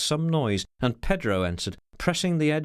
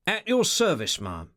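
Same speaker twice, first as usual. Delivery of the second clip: exploding greeting; 6 semitones higher than usual. Acted. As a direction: boom it out with a flourish. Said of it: "His voice exploded in the room".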